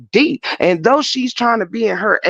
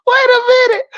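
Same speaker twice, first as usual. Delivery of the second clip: startled high shout; sudden shout. Real, not acted. Sudden outburst during retelling.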